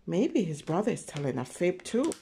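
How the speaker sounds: ordinary delivery, nothing marked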